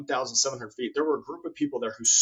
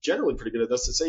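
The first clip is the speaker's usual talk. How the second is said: ordinary talk, nothing marked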